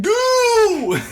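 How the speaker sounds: very high, very loud mock yell; shouted quote. Friend acting out the movie scene with a shrieking yell. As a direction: scream it out like a goofy kid. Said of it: "he's like".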